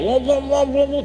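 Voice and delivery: a hint of a grumbling woman; mock nagging. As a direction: mimic her nagging babble. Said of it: "tapi ngomel-ngomel".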